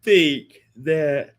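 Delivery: words stretched out by a yawn. Real, not acted. Host caught mid-sentence by a yawn, yawning. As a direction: talk through a big yawn. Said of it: "I'm yawning".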